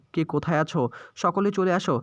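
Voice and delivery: ordinary delivery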